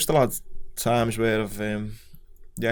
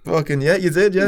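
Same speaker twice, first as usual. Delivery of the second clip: louder, pitched-up laughing insistence; laughing confirmation; 7 semitones higher, 9 dB louder than usual. Retold or imitated. Laughingly telling his mate yes, he did it. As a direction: laugh it out, teasing your mate. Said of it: "I was like".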